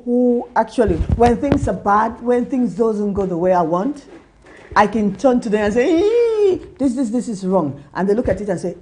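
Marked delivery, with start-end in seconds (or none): 6.0-7.8 high squeal breaking into a teary laugh; a hammed-up upset friend; mock distressed wail. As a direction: whine it like you're half crying, half laughing. Complaining to supportive friends.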